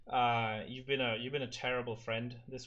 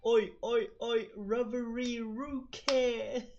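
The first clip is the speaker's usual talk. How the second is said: Kenshiro impression; 11 semitones higher, 5 dB louder, much slower than usual. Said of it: "Kenshiro going like".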